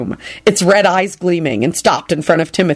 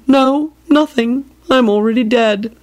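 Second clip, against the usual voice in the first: moaning; 5 semitones higher than usual. Acted.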